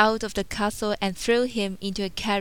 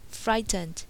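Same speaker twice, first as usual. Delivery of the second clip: usual speaking voice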